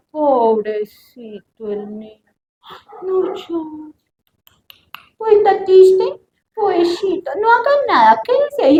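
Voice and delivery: slow cooing pity rising high; baby the child with mock pity; pitying coddler. Padres que consienten la tristeza. (padre que consiente, con lástima exagerada)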